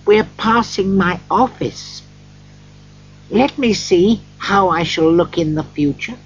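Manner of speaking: put-on high anxious old man; eager, worried miser; Scrooge. A narrator's reading. Scrooge asks the ghost.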